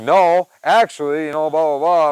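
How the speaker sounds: mock a huffy Karen putting him in his place. Retelling telling off a coworker, in a slow loud Karen tone. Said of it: "Karen tone".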